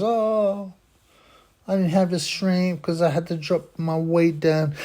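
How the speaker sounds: high-pitched mock protest; mock excuse-making. Acting out loser's excuse.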